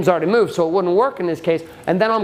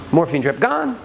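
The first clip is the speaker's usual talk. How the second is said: ordinary talk, nothing marked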